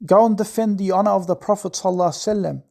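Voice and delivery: usual speaking voice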